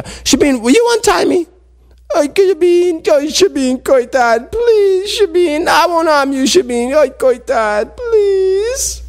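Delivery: very high, whining mock-tearful begging; pleading. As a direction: wail piteously to be untied, hammed-up. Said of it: "Nolob was convincing".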